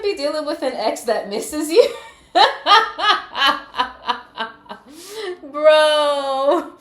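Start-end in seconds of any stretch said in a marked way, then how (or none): 2.3-5.5 loud, high cackle; laughing. Real, not acted. Reader amused at an ex missing you, high cackle. 5.5-6.7 loud, high laughed-out word; laughing. Real, not acted. Reader cracking up before viewers, helpless laughing. Still laughing at own joke.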